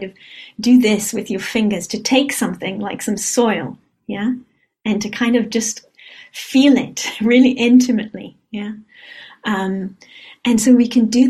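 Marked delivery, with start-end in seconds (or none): none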